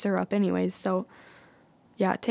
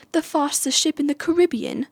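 higher-pitched bad British accent, Caribbean as kuh-RIB-ee-un; badly faked British accent, stressing RIB in "Caribbean"; Elizabeth Swann; 7 semitones higher than usual. Vlogger imitating a film heroine's wrong version, bad British accent. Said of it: "worst accent I've ever done".

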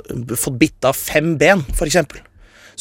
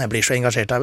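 ordinary delivery

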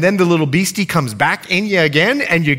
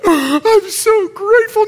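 slow, crying high voice of thanks; tearful thanks; 5 dB louder, slower than usual. Retold or imitated. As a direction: let the voice break with gratitude. Woman crying with gratitude.

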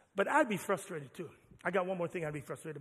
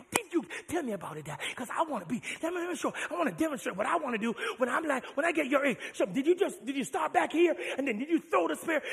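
put-on higher, excited little boy voice; eager kid; 7 semitones higher, 5 dB louder than usual. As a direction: bounce like an eager son. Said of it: "My son says".

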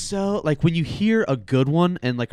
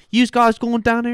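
high, louder mock Philly call; Philly accent; 9 semitones higher, 5 dB louder than usual. Shown as a demonstration. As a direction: call out like a Philadelphian. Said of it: "there's the Philly".

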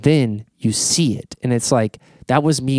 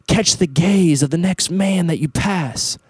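high, drawn-out drill leader's call; imitated yell; 7 semitones higher than usual. Retreat leader calls out to the men, imitated yell. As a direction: call it out like a leader commanding a circle. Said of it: "he would yell out".

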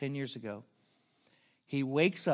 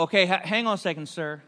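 flustered and hurried, louder and higher; flustered mom; 4 semitones higher, 9 dB louder than usual. Retold or imitated. Shy mom stalling her son near bikers, hurried and flustered.

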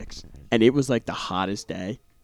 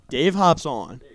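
emphatic, louder sarcastic delivery; 4 semitones higher, 6 dB louder, much slower than usual. Real, not acted. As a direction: say it loudly with sarcasm. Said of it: "He said it very sarcastically".

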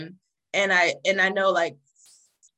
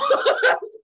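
high nervous laugh; 7 dB louder than usual. Real, not acted. Nervous laugh after story.

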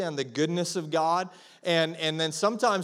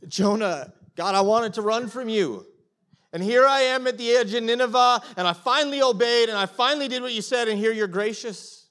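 Jonah's indignant complaint; 5 semitones higher, 5 dB louder than usual. Retold or imitated. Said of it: "it's like".

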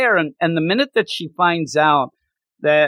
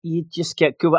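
normal talking voice

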